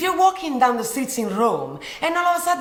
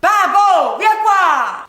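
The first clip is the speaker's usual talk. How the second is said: loud Italian holler; loud call; 2 semitones higher, 6 dB louder, slower than usual. Pretend. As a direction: holler to summon someone over. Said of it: "calling you so loud".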